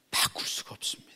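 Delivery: hushed, breathy emphasis; whisper. Real, not acted. Preacher to congregation, solemn whisper. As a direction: lean in and whisper it.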